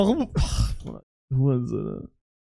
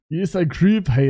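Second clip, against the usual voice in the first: usual speaking voice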